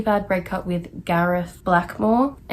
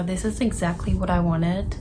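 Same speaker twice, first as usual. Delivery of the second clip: ordinary delivery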